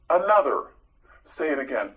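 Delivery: normal talking voice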